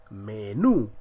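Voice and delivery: menú with "nú" drawn out; stress on final syllable. Shown as a demonstration. Teacher listing aguda examples, menú stressed on "nú". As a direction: punch the "nú" in menú. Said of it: "la mayor fuerza de voz en la última sílaba".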